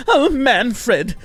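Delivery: high, hammed-up posh gentleman calling out; mock a prissy aristocrat; posh Emmerich. Mocking Emmerich calling his skeleton servant, hammed-up posh. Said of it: "Emmerich is too posh".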